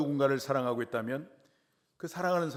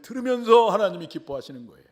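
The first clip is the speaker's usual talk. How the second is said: higher, loud, full of delight; joyful outburst; 8 semitones higher, 12 dB louder than usual. Acted. Preacher telling the church how God rejoices, loud and joyful. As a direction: burst out with God's joy. Preacher's climax on God's joy.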